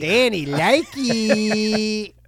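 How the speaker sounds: put-on slow drawn-out baby talk. Cohost mocks himself doing bedroom baby talk, slow coo. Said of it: "You go".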